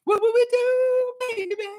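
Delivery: a fan mock-gushing, then the falsetto line sung high; light fan voice into a high falsetto; imitating a fan. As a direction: play an impressed listener, then hit the high note. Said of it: "He's like".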